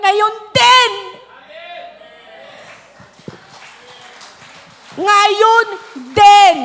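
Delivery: triumphant loud proclamation; proclaiming shout. Acted. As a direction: shout it out as a promise. Jesus proclaims to thief.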